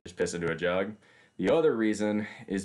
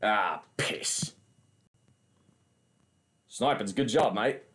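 mock Australian accent; slower than usual. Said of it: "I can do the Australian".

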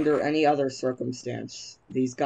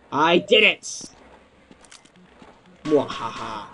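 mad scientist; 2 semitones higher, 5 dB louder, slower than usual. Acted. Villain cackle after rocket kill.

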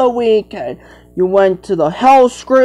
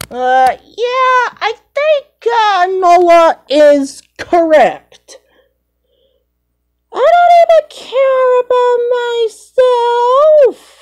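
very high, squeaky toy character; squeaky cartoon voice; 3 dB louder than usual. A child voicing a toy, very high and squeaky. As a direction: squeak it out like a tiny cartoon critter.

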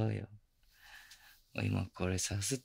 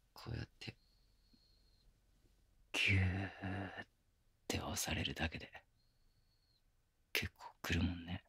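whispering; 3 semitones lower, 7 dB quieter than usual. Acted. Whispered teasing in her ear.

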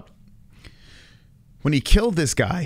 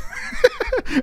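sudden high-pitched laugh; burst of high laughter. Real, not acted. Dad cracking up at his own story, laughing high.

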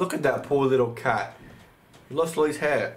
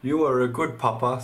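ordinary talk, nothing marked

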